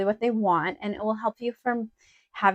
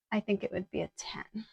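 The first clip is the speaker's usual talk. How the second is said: sudden breathy whisper, much softer; whispered aside; 11 dB quieter than usual. Real, not acted. Rating her outfit to viewers, in a whisper. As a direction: drop to a private whisper as an afterthought.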